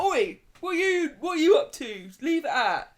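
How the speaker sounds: put-on cockney dad shouting at the kids; cockney Kevin. Kevin tells the kids off in cockney. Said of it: "Why have they all gone cockney?".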